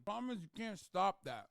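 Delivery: ordinary delivery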